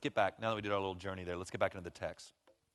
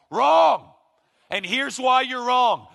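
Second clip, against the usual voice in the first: much higher, louder, hammed-up cry of wrong; mock shout; 13 semitones higher, 15 dB louder, much slower than usual. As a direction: bark it out like a know-it-all husband. Man cuts off his wife.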